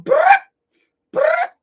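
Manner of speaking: high, loud imitated walkie-talkie chirp; mimicking a Nextel beep. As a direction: chirp like an old push-to-talk phone. Remember the Nextel?.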